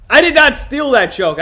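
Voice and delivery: loud protest. Real, not acted. Defending joke against chat.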